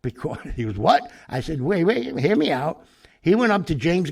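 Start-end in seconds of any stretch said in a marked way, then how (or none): 0.8-1.1 loud, high, startled what; mock shocked yelp. Nephew's shocked reaction. 1.6-2.9 louder, higher, hurried hold-on; waving him down to explain; lively retold urgency; urgent plea.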